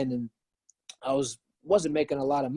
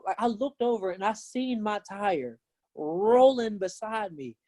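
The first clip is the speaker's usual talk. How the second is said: usual speaking voice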